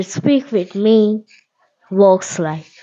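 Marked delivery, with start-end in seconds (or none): none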